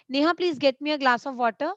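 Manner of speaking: fast, no pause after "Neha"; rushed in one flow. Shown as a demonstration. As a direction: blur it all together, the wrong way. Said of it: "No, not like this".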